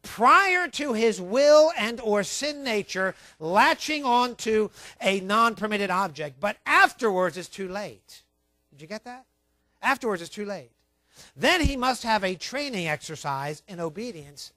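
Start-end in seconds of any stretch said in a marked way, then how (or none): 0.0-8.2 weighty slow-read warning; slow emphatic reading. Acted. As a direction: read the quote out slowly and heavily. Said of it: "watch this".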